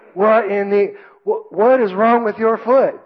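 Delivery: high, drawn-out indignant sergeant; drill sergeant. Retold or imitated. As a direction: imitate a sergeant chewing out a recruit. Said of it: "drill sergeant walks behind me".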